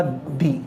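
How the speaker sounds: "दी" with an i vowel; "दी", not duh; pre-vowel "the". Shown as a demonstration. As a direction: say "दी" as dee, as before a vowel sound. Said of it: "उसका pronunciation होगा".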